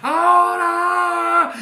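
high, drawn-out rallying cry; exultant, sustained preacher's shout; celebrating shout. Real, not acted. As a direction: whip the congregation up, holding each vowel. Whipping congregation into celebration.

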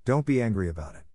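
ordinary delivery, nothing marked

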